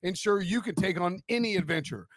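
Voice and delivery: upbeat sponsor read, pitch lifted; lilting promotional read. Acted. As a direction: sell it like a podcast car ad. Reads a sponsor ad.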